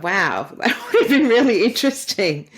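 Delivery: laughing as you retell it; amused wonder. Retold or imitated. Retells own reaction, laughing.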